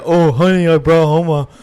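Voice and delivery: loud, high hammed-up husband bragging; mock proud husband. Husband proudly announcing turkey to wife, loud and high. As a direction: boast like a proud dad at the door.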